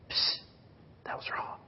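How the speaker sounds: soft unvoiced whisper; hushed whispered correction. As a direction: lean in and whisper it like a gentle nudge. God gently nudging the sinner.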